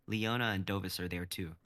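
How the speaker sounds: normal talking voice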